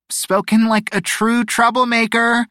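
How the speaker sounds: high, carrying call; calling after someone. A narrator's reading. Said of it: "Yolanda's voice carried after him".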